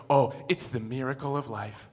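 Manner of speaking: mock awe. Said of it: "you just look at it and you go".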